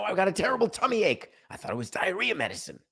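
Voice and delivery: fast, high mock whine; a hammed-up ballplayer pleading with a pained tummy; whiny excuse. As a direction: rattle off a sheepish excuse quickly. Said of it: "Robinson Cano".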